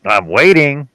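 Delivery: put-on impatient prompting; mock impatient. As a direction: pretend to wait impatiently. Acts out son waiting for reply.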